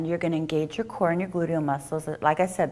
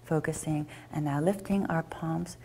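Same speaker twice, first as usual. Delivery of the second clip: calm, quieter guided yoga cue; soft yoga guidance; 6 dB quieter than usual. Acted. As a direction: ease the class gently into the pose. Guiding tree pose breathing.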